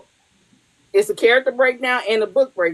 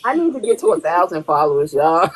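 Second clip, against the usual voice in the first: usual speaking voice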